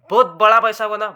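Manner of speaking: loud emphatic boast. Real, not acted. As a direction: hit it hard with pride. Stressing a big profit.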